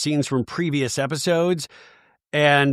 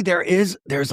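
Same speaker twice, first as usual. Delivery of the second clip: usual speaking voice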